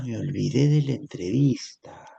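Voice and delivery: whispered guilty confession; susurro culpable. Acted. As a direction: breathe it out like a caught kid. Hijo confiesa a su mamá. (confesión susurrada, como niño pillado)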